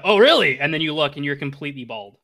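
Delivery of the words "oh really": loud, high, indignant retort; raised-voice comeback from the narrator; offended narrator. Acted. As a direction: snap back loudly as a slighted narrator. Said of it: "you hear a".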